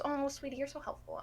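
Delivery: high, soft, gushing voice; sweet lady. Retold or imitated. X-ray lady praising a helpful patient, sweet and cooing. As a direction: coo it like a kindly older helper. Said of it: "she's the sweetest woman".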